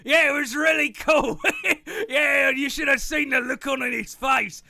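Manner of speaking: boastful merchant. Acted. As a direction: merchant brags about a prank. Merchant tells school story.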